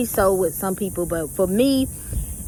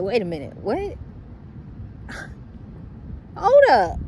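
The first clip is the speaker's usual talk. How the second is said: shocked disbelief; 3 semitones higher than usual. Retold or imitated. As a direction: jolt from doubt into alarm at the empty spot. Discovers her car is gone.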